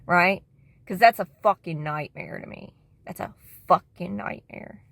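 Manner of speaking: ordinary talk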